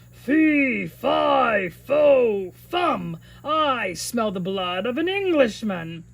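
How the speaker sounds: loud booming giant chant; giant's roar. Acted. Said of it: "Jack heard him roar".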